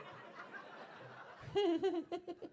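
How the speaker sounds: high, camp giggle; a camp shriek of a laugh; squealing camp laugh at the heckle. Real, not acted. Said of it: "I've got a camp laugh".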